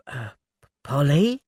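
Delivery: usual speaking voice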